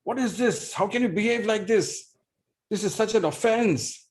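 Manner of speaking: father scolding his son for eating the offering; angry scolding. Acted. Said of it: "He screamed at Nimai".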